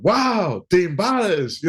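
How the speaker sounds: high, lightly mock-impressed chorus; imitating the band's mild mock wonder; mock amazement. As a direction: tease him with fake awe. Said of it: "clowning me like something serious".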